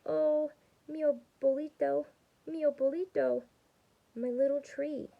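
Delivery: a touch of grief for the tree; mild sad lament. Acted. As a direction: lightly mourn the broken tree. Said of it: "A tear slid down her cheek".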